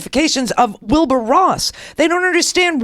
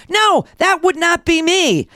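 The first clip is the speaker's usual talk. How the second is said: firm shout; 5 semitones higher than usual. Real, not acted. Retort to accusers.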